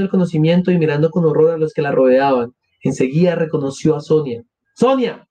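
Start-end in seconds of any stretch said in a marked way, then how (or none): 4.7-5.3 short high-pitched call of the name; surprised exclamation. A narrator's reading. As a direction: call out as if surprised to see her. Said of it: "exclamó". (exclamación aguda de sorpresa)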